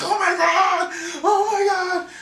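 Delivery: high, laughing and crying at once; overjoyed crying. Retold or imitated. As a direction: sob it out in disbelief. Fell on the floor and started crying.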